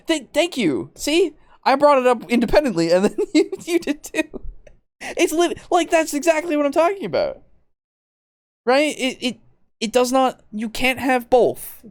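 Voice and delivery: squeaky indignant rant; high excited rant. Real, not acted. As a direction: voice up high, fired up. Excited rant against co-host.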